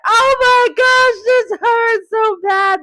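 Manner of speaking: drawn-out, loud high wail; retold cry of pain. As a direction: act out wailing in sharp pain, slowly. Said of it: "because I'm having this pain".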